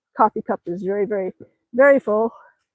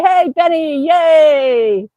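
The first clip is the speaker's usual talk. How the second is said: cheerful call, a bit higher; 3 semitones higher, 5 dB louder, much slower than usual. Real, not acted. Vlogger greeting viewer Benny in chat, cheerful call.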